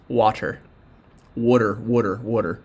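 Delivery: Philly "water", said over and over in his own accent. Shown as a demonstration. For a fan who loves it, repeating water in a Philly accent. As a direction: roll out "Water" with a broad Philly vowel, like a hometown demo. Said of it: "I'm gonna say water".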